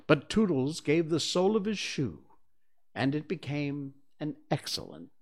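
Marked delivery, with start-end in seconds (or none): none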